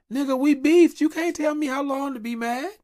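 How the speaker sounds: mock outraged shouting; heated shout. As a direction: fire back hard at someone telling you how to feel. Heated retort to a grudge-policer.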